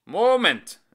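high loud call to wait; loud shout. Acted. Singer stops the couple, shouting. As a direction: shout them back.

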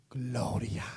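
breathless whispered gloria; barely a breath, drained of strength. Acted. Beaten Silas answering Pablo in the dark, whispered.

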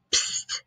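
soft unvoiced psst; whispered psst. A narrator's reading. Boy trying to wake the mouse, whispered psst. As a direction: hiss for attention. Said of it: "whispered the boy".

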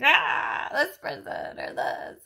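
loud, breathy exasperated "ah"; vent, mildly hammed-up; frustrated outburst. Mom recalling her anger coming up, in a mock outburst. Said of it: "We're like".

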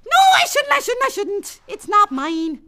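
put-on high, loud child scolding herself; a little girl fighting temptation; high self-scolding child; child resisting temptation.

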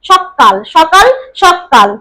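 সকাল said slowly as শক্কাল, ক doubled; slow stressed double k. Shown as a demonstration. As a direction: lean on the ক each time. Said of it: "কয়ের উপর শাসাঘাত দিয়ে ফেলেছি".

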